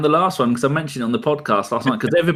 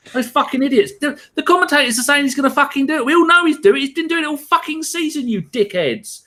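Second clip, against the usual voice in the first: shouting at everyone who saw it coming; a fed-up fan ranting, pitched way up; furious rant; 9 semitones higher than usual. Real, not acted. Ranting at commentators and wall.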